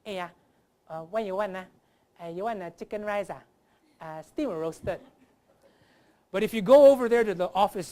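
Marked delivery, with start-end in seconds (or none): 0.0-5.0 broad Singlish of a food seller; short phrases in thick Singaporean accent; Singaporean heartlander accent. Acted. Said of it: "the Heartlanders, they sound like this".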